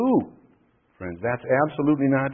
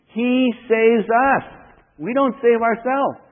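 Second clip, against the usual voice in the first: high, raised proclamation; emphatic preaching; 9 semitones higher, 4 dB louder, slower than usual. Real, not acted. Preacher tells congregation who does the saving, emphatic proclamation. Preacher hammers the key doctrine.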